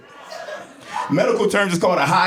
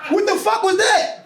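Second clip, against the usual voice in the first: very high, squealing disbelief; higher yelping disbelief; more baffled. Acted. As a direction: push the confusion to a squeak. Repeat, even more baffled.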